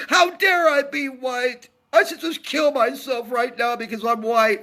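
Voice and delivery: high, mocking sobbing whine; mock whiny apology. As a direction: sneer it out as a fake weepy apology. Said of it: "cry ourselves to sleep and say".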